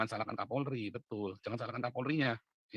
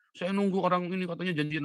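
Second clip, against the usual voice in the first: imitating a complainer; 6 semitones higher than usual. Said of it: "YouTubers ngadu ke saya".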